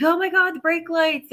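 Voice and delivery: high-pitched mock alarm; hammed-up backseat-driver fright, pitched high; panicky passenger. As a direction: imitate a nervous passenger crying out to the driver. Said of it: "world's worst passengers".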